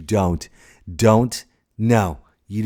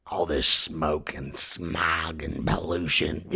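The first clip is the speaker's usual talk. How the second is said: slow, low, raspy Mother Earth; gruff, weary rasp; Nick Nolte; 6 semitones lower, 3 dB quieter, slower than usual. Acted. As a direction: drag it out like smoke-choked lungs. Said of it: "Does Mother Earth sound like Nick Nolte?".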